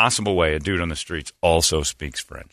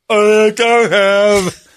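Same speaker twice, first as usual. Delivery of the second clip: much higher and louder, words stretched very slowly; dopey character voice; 11 semitones higher, 5 dB louder, much slower than usual. Pretend. Comic dodging a beggar, in a slow, high dopey voice. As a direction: play a slow-witted guy, dragging every word. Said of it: "he went full R word".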